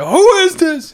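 very high, loud cry of disbelief; over-the-top startled demand; mock shock. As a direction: shriek the question in hammed-up alarm. Acting out player's shocked question.

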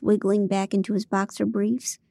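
usual speaking voice

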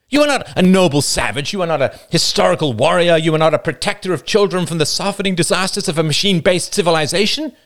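a man arguing with rising passion; David's impassioned speech. Acted. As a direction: confront the child-beater with fervent conviction. David confronts Roman.

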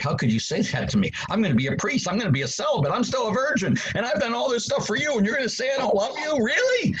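building outrage at God, voice soaring up; upset with God. Retold or imitated. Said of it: "I was so upset".

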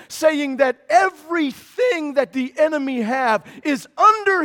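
loud, rising sermon proclamation; a fiery preacher declaring Jesus' promise; full-force preaching. Acted. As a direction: preach it out to the whole room. Preaching on the empty tomb.

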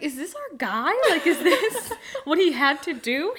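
a friend's quote half-lost to laughter; laughing quote. Retold or imitated. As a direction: giggle through the question, slowing down. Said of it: "Owen's like".

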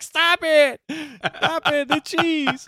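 very high tearful wail, imitated; mock crying. Husband imitates wife seeing the cheese, mock crying. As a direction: imitate an upset wife's high cry. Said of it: "she started to cry".

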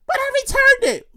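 high-pitched little woman's voice; finder confessing. Acted. Woman admitting to family she returned it, high-voiced. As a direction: put her voice up high for the admission.

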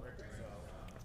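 distant crowd echoing the word; the room repeats the word back; far-off voices in reply; congregation answers from afar. Real, not acted. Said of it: "Everybody say reconciled".

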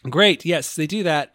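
ordinary talk, nothing marked

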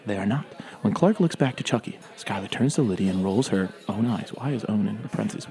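ordinary talk, nothing marked

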